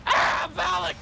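ordinary delivery

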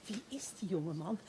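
whispering to the innkeeper, asked quietly. Retold or imitated. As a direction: lean in and ask it under your breath. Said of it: "ze vraagt hem zachtjes".

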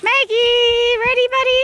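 high, loud call to a dog; squeaky dog voice. Real, not acted. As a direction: hype the dog up to play. Calling dogs to play ball.

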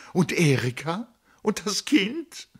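high, imploring questions; desperate plea. Acted. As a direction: beg him, voice climbing. Tony pleading for her family.

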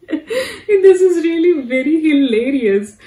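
can barely get it out for laughing. Real, not acted. Vlogger laughing at the clip, giggling through it.

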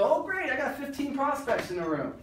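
high, eager mock delight at spotting buyers; greedy mock glee. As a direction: play the salesman counting his prospects, high and eager. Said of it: "they look around and they go".

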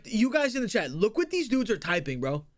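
ordinary talk, nothing marked

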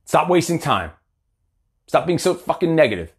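ordinary delivery

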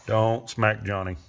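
slow, firm warning; firm scolding. Real, not acted. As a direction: scold a pet slowly and firmly. Scolding a misbehaving pet.

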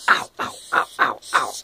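sharp, loud yelps of real pain. Real, not acted. Keeper pinched by her roach, real loud yelps. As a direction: yelp as the bug pinches you.

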